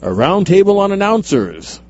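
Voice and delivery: high, loud announcer billing; mock announcer. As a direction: announce the topic like a show host. Mock show announcer continues the plug.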